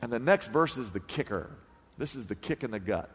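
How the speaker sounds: preacher goes quiet before the kicker; hushed. Real, not acted. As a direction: drop the voice to a near hush. Hushed drop before key verse.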